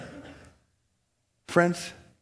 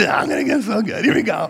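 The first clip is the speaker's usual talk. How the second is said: higher and louder gleeful boast; 5 semitones higher, 3 dB louder than usual. Retold or imitated. Poster ready to post, higher gleeful boast. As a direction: crow it out, hammed-up glee. Same words, gleeful second take.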